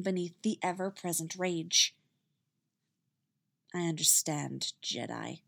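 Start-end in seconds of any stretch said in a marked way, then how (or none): 3.7-5.4 low, soft, flat and cold; cold and detached. A narrator's reading. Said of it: "Her voice had gone flat and cold".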